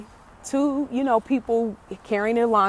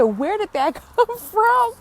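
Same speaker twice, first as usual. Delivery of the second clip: high-pitched question breaking into laughter; laughing disbelief, voice shooting up; busted out laughing; 12 semitones higher, 4 dB louder than usual. Retold or imitated. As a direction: retell it while cracking up. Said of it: "I just busted out laughing".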